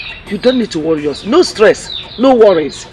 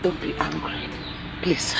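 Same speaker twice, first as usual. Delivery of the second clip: hushed plea; 16 dB quieter than usual. Acted. Mother pleads with angry daughter.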